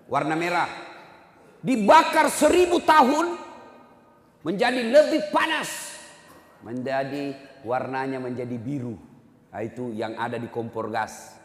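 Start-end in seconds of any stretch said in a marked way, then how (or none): 1.6-6.0 loud, high, slow preacher's cry; preaching at full force. Acted. Preacher describes hellfire to the congregation, loud and slow. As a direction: drag it out like a warning of doom. Fiery sermon on hell.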